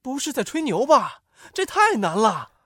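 high-pitched disbelieving soldier; lightly acted soldier in disbelief; incredulous uproar. A narrator's reading. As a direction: doubt the boast, voice pitched high. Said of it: "士兵们炸开了锅".

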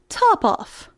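coolly stated, falling at the end. A narrator's reading. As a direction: answer as the cat, quite unbothered. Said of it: "said the cat quite coolly".

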